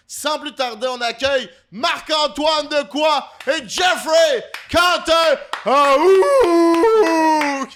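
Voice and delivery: drawn-out roaring announcer shout, very high and loud; viking war cry. Acted. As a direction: bellow the names like a viking battle cry. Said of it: "j'ai essayé de faire mon cri de viking". (cri de viking hurlé)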